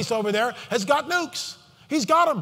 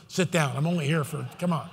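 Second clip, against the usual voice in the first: ordinary delivery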